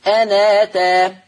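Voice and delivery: أنا تا chanted slowly, the nun carrying the hamza's fatha; Quranic recitation with transfer. Shown as a demonstration. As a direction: recite أنا تا with naql, slow and sung. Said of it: "لما أجي أقرأها".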